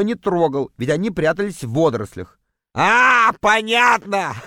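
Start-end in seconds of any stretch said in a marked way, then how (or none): 2.7-4.5 high-pitched amused voice breaking into laughter; gleeful, hearty laugh at another's misfortune; guffawing. A narrator's reading. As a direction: crow with delight, then cackle. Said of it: "Кретин ещё и заржал".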